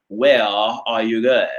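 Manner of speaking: imitating a non-rhotic British accent; mock British accent, no R sounded in "Where" or "are"; British English. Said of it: "that British English".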